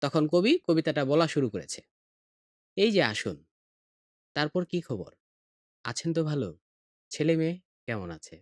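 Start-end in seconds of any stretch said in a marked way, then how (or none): 2.8-8.4 unhurried greeting with long pauses between phrases; slow with pauses. Shown as a demonstration. Teacher reciting the poem's greeting to the guest, slow and pausing. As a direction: let each question sit before the next. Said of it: "এত আস্তে আস্তে বলল".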